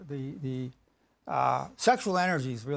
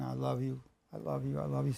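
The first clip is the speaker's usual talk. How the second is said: softer, murmured to yourself; quiet inner thought; 6 dB quieter than usual. Shown as a demonstration. Showing the loving thought you repeat, said softly. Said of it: "so you got this thought going".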